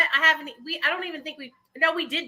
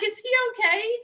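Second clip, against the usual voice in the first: blubbering; 11 semitones higher than usual. Real, not acted. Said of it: "sorry, I'm crying".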